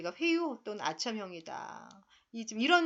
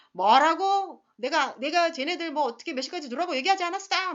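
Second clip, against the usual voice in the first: angry shout, louder and higher; father yelling; 6 semitones higher, 8 dB louder than usual. Retold or imitated. Angry father shouting at the mother about the kids. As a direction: blast the father's accusation at the mother. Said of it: "막 이러면서 아빠 소리 지르죠".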